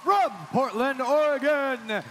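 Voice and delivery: booming, high team introduction, vowels stretched long; arena announcer. Real, not acted. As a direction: roar the team name slowly to the crowd.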